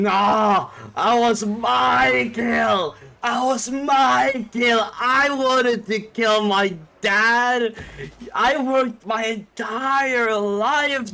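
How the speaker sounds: high, slow, furious shout; screaming in rage. Retold or imitated. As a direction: howl it out like a robbed man. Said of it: "like, just screaming".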